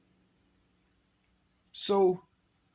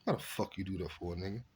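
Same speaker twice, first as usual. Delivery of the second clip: much softer, lower grumble; low muttered complaint; 7 semitones lower, 10 dB quieter than usual. Retold or imitated. To cousin after hearing Killer's name, low softer grumble. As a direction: grumble it under your breath. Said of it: "I'm like".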